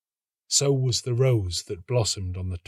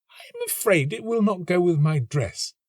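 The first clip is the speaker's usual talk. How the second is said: higher, prim and dismissive; girl's dismissive voice; 6 semitones higher than usual. A narrator's reading. The professor's daughter rejecting the rose, higher prim voice. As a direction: turn him down coolly. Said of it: "she answered".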